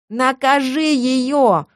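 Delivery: high, long-drawn wail; shrill, aggrieved howl. A narrator's reading. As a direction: wail the demand, stretching each word. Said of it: "взвыла Сильва".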